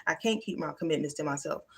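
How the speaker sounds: low, soft self-doubting voice; lightly acted, subdued self-talk; lying inner thoughts. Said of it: "them stupid lying thoughts be saying".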